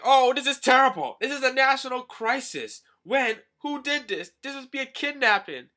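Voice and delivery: high, loud-ish indignant minister; hammed-up outrage at a national crisis; mock outrage. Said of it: "the minister's all pissed off".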